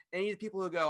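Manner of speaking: usual speaking voice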